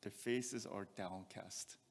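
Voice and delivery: "Their faces are downcast" drawn out and soft; slow and heavy. Shown as a demonstration. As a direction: dwell on "downcast" with low spirit. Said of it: "Downcast means low in spirit".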